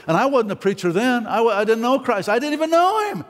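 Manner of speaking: exasperated, high-pitched admission; emphatic confession. Real, not acted. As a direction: pitch climbing to an incredulous peak. Preacher's climactic confession of not knowing Christ.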